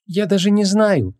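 high, slow, hesitant woman; unsure what to say, drag it out awkwardly; awkward mumbling. A narrator's reading. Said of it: "неловко промямлила Вон".

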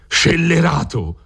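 slow, high drawn-out cry of outrage; furious yell. Acted. Don Rodrigo yelling at the treacherous Griso. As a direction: hurl the insult at the traitor. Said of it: "urlò Don Rodrigo".

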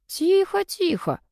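high-pitched hushing; cutting in. A narrator's reading. Brother stopping his sister's chatter, cutting in. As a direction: rein her in with a high, drawn hush. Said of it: "притормозил меня брат".

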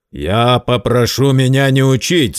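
a loud, cutting rebuke; spiteful retort. Acted. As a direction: cut him off with malice. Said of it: "злобно отрезал".

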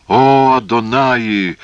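slow, high cry to God; loud exclamation. Acted. Phasael cries to God at the mutilation, loud exclamation. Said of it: "воскликнул Фазаэль".

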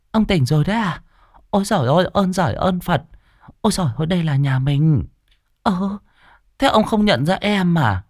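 high-pitched fussing wife voice; anxious wife calling. Acted. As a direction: fuss over him, half relieved, half worried. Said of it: "vội vàng kêu". (bà Tư mừng rỡ cuống quýt gọi chồng)

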